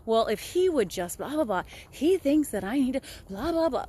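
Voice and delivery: lightly acted griping complaint; a hint of an exasperated blamer; mock blaming. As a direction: reel off a grievance with dismissive blahs. Voicing a blamer's written complaints.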